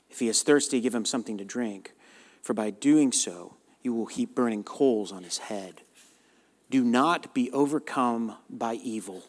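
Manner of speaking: quiet, measured Bible reading from the pulpit; scripture reading. Acted. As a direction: read the verses solemnly and softly. Pastor reads scripture aloud.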